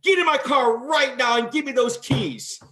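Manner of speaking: loud, high barked command, staged anger; dad's yelled order. Retold or imitated. Dad ordering his son in front of the cop, loud staged anger. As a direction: order the kid into the car like a fuming parent. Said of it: "He starts yelling".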